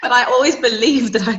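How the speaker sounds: real laughter spilling into the sentence; laughing. Real, not acted. Actor laughing at her own self-belief after admitting she wasn't good, laughing.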